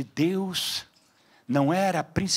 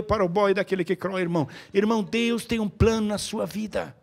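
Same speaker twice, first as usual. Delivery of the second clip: building exhortation; 6 dB louder than usual. Acted. Rising exhortation to congregation.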